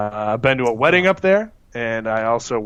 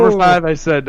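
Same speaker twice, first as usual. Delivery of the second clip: louder restart of "Number five"; loud call; 5 semitones higher, 6 dB louder than usual. Real, not acted. Reclaiming the floor after the joke: loud call. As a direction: call it out over the noise. Shouting over the laughter.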